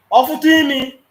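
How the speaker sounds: loud, high outburst on "Ọ fụtụ imi"; loud shout. Real, not acted. Caller shouting his threat at the panelist, loud. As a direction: shout the first half, then drop.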